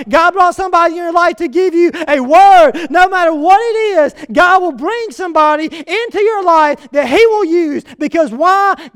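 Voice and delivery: high, loud, driving sermon delivery; full-force preaching. Real, not acted. Preacher tells congregation God brought somebody, full-force preaching. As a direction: build insistent repetitions at the top of your voice.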